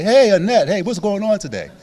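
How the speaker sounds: high, loud cheerful hello on the phone; cheery phone greeting. Pretend. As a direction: greet her brightly like a friendly call. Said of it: "pick up the phone and say".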